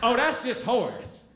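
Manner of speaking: high, drawn-out dismissive imitation; Tracy. Girl unmasking him before everyone, mock dismissive. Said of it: "Tracy was always real aggressive".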